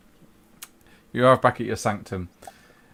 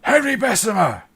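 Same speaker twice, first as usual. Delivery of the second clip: loud, high shout across the chambers; 12 semitones higher, 8 dB louder than usual. Acted. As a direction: bellow his name to stop him in his tracks. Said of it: "and he just shouts".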